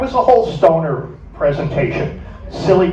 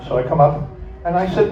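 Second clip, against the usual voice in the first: ordinary delivery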